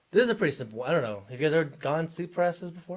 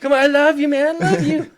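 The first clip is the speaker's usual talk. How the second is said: much higher, louder, laughing begging; laughing plea; 13 semitones higher, 11 dB louder than usual. Pretend. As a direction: beg your Pokemon through laughter. Pleading with Pikachu.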